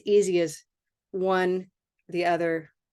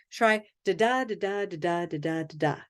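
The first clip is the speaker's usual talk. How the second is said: da da da chanted in a steady beat, fading down; rhythm drill; 4 dB quieter than usual. Shown as a demonstration. Teacher leading the class in a rhythm drill, chanted. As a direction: chant the da pattern like a metronome.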